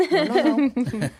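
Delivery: laughing it off, no no no; chuckling through the words. Real, not acted. Laughs off a bad idea.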